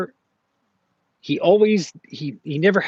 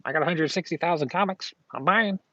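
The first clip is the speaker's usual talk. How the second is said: hammed-up boast of a buyer; imitating the comic guy; 7 semitones higher than usual. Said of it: "He's like".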